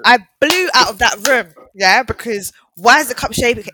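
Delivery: loud, animated storytelling; excited and loud. Real, not acted. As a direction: raise it up, buzzing with the story. Retelling fleeing the Ouija board.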